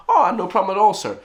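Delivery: high, lifted manager voice; obliging manager. Retold or imitated. Manager answering an order for four patties, mock obliging.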